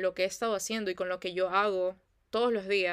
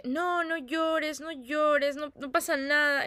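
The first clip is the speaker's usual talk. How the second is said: high, stretched-out cooing mom; दूसरी माँ की पुचकार; 6 semitones higher, slower than usual. Retold or imitated. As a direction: imitate a mother hushing her baby. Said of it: "llega la otra mamá y le dice".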